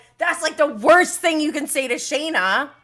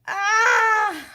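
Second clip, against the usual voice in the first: high loud cry of feeling; loud emotional wail; 10 semitones higher, 4 dB louder than usual. Real, not acted. Host overwhelmed by the episode, loud wail. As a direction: let out an overwhelmed yell.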